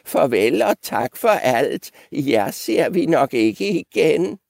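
put-on high, lilting old-goat farewell. Old goat expecting never to see them, high lilting goodbye. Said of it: "sagde den gamle gedebuk".

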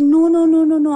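a sharp, loud, rising string of no's; loud refusal. Retold or imitated. Benazir refusing the TV interview to the journalist, loud insistent no's. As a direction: shut the idea down hard and loud.

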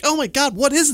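high, startled gasp of words; alarmed. Retold or imitated. Waking to drone in dream.

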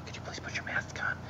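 soft whispered request. Retold or imitated. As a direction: whisper it politely. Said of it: "the lady that was on there said".

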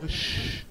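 voiceless hushing shh. Retold or imitated. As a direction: hush them fast. משתיק את החברים. (השתקה בלחישה)

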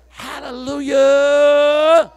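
high, loud revival preaching; preaching at full force. Acted. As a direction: lift the whole room as you preach.

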